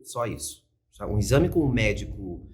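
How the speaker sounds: ordinary delivery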